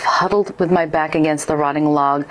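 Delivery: ordinary delivery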